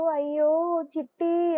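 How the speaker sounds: high, loud, drawn-out groan of protest; collective exclamation. A narrator's reading. All the friends react to Chitti's idea, loud exclaiming. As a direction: cry out slowly, stretching the name.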